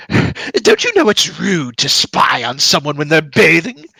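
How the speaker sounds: put-on loud, scandalized old eccentric; indignant old mage. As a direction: scold the peeping intruders. Game master voices startled mage.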